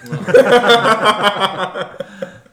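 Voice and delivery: big high burst of laughter; loud laughter. Real, not acted. Joke about old neighbours.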